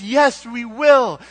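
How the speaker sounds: loud, slow, high joyful vow; Israel's loud, glad wedding vow; eager yes. Acted. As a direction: cry out the promise like a bride saying I do. Israel answering Yahweh's wedding pledge.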